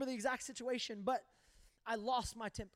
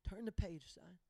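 softer, steady fatherly command; calm firm father; 6 semitones lower, 8 dB quieter than usual. Retold or imitated. Dad answering his upset son, a soft, steady firm voice. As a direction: say it quiet and sure, like dad.

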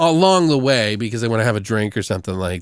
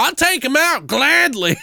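much higher, eager hitman-trucker parody; gleefully offering, pitched up; mock trucker; 12 semitones higher than usual. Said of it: "He's like".

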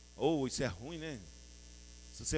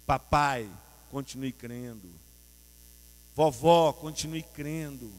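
tender encouragement; 3 semitones lower, 5 dB louder than usual. Acted. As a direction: speak it to each one like a blessing. Addresses each listener gently.

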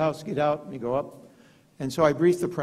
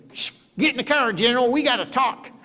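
higher, louder put-on president voice; the president's voice; 11 semitones higher, 5 dB louder than usual. President urging the general into his car, in a higher put-on voice. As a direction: hurry him into the car as the president.

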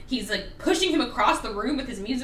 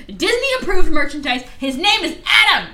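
loud, high insistence; emphatic rant; 6 semitones higher, 10 dB louder than usual. Real, not acted. Ranting to listeners about Adam, loud and high. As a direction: hammer the point home.

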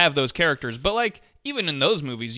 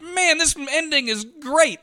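mock delighted; 11 semitones higher, slower than usual. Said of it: "I was like".

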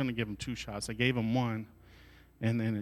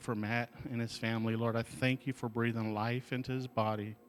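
prayer; 3 dB louder than usual. Acted. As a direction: pray over the kids in the room. Leads a prayer.

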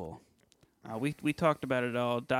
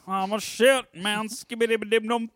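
silly singsong; 9 semitones higher, 6 dB louder than usual. Pretend. Silly chanting, laughing.

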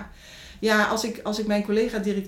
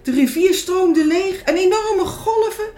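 rising higher and louder as the world shakes; building to loud; 11 semitones higher, 13 dB louder than usual. A narrator's reading. Narrator describing the shaking world, growing loud and high.

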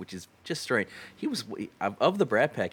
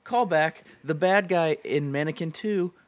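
excited; 9 semitones higher, 6 dB louder than usual. Real, not acted. Excited recall of forgotten actor.

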